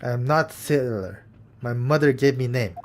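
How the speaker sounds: put-on lightly Russian-accented gruff crewman; mild Russian accent, stiff and proud; Russian sailor.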